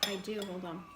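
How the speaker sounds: talking while chewing; words round a bite; mouth full. Real, not acted. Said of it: "I'm chewing".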